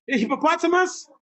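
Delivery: high, loud playful tease; high laughing teasing question. Real, not acted. Teacher teasing child, laughing.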